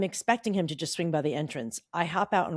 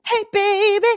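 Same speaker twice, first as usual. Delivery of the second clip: high, loud mock flirt; hammed-up flirty greeting; flirty come-on; 14 semitones higher, 11 dB louder than usual. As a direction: tease it like a cheesy pickup line. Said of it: "You're like".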